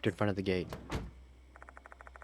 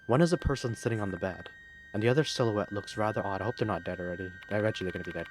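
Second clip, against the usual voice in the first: usual speaking voice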